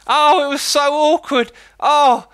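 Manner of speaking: high, loud squealing cringe. Acted. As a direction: shriek it in secondhand embarrassment. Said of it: "Oh, I'm shivering".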